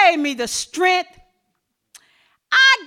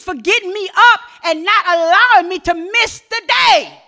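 loud, rising preacher's cry; full-force preaching; 4 semitones higher, 7 dB louder than usual. Acted. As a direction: preach it to the congregation with fire.

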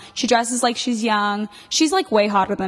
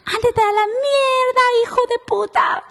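high shrill-pitched yell in Spanish, far above own voice; grandma yelling from the window; screaming curse; 4 dB louder than usual. Retold or imitated. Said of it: "sticks her head out the window and screams".

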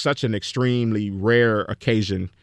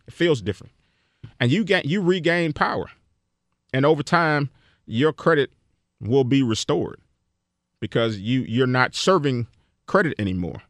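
normal talking voice

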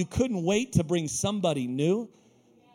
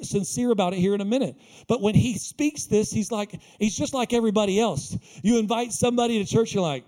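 ordinary talk

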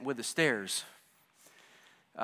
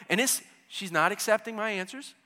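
ordinary talk, nothing marked

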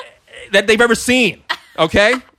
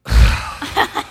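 heavy sigh; 7 semitones higher than usual. Retold or imitated. Woman greets them with sigh.

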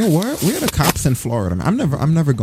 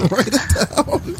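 much higher, very slow teasing; high mock voice; 11 semitones higher, much slower than usual. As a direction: stretch it out high and mocking. Repeats own line mockingly.

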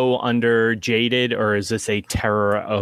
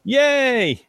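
high-pitched drawn-out "yay"; a squealed little hooray; mock cheer; 13 semitones higher than usual. Player greets bad news of gained insanity, high mock cheer.

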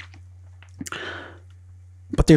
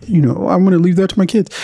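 high, prissy put-on voice mocking the legacy crowd; mocking imitation; 7 semitones higher than usual. Imitating people who leave money to their kids, high mocking voice. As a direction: lift the pitch and sneer through it. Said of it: "a lot of people who are gonna be like".

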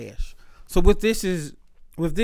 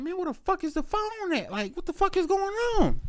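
frantic disbelief; 12 semitones higher, 5 dB quieter than usual. Retold or imitated. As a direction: squeak it out in panicked disbelief.

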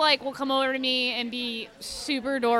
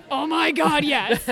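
excited yes; 3 semitones higher, 5 dB louder, slower than usual. Retold or imitated. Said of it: "And I was like".

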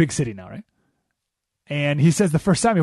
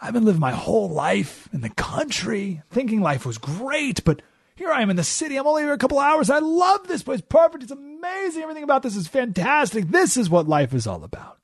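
thrilled country mouse; 7 semitones higher than usual. Acted. Country mouse marveling at the city feast, rising much higher and giddy. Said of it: "He's like".

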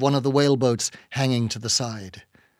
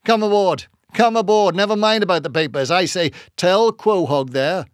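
higher, brisk, urgent invitation; hearty calling aboard; 6 semitones higher, 4 dB louder than usual. A narrator's reading. Peleg calling the new hands aboard, hearty. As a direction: wave them aboard with eager insistence.

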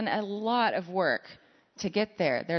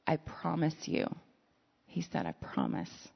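very soft, hushed, slow reassurance; almost breathe the promise to a frightened friend; tender promise; 7 dB quieter than usual. Acted. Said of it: "very intimately".

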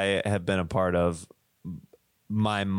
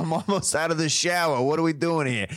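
mock impatient parent; 6 semitones higher than usual. Voicing impatient mom.

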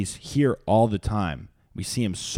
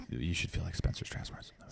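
whisper; 5 semitones lower, 13 dB quieter, faster than usual. Acted. Hushed aside after impression.

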